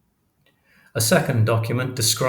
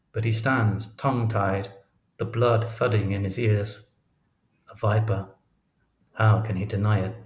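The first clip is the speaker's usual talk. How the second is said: usual speaking voice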